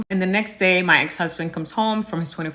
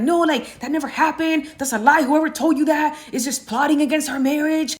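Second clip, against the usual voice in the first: high, hurried mock pleading; panicked rambling husband; 8 semitones higher than usual. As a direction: babble excuses, caught out and scrambling. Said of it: "he just started rambling".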